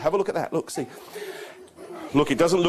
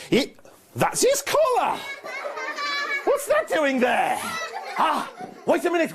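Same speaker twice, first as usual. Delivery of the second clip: high, hammed-up bafflement with a laugh; mock surprise. Magician pulls a collar from the bag for Paul, mock surprise. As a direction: act surprised for the children. Finds the collar, not the dog.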